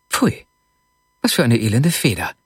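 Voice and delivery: mildly disgusted outburst; sudden exclamation. A narrator's reading. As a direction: exclaim with a touch of annoyance. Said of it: "rief Schumkow plötzlich aus". (leicht verärgerter Ausruf)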